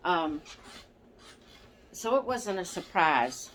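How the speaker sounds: ordinary delivery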